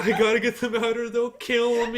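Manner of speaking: high, loud words broken by real laughter; can barely get it out for laughing; laughing through words. Real, not acted. Laughing while previewing the story.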